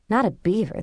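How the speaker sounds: usual speaking voice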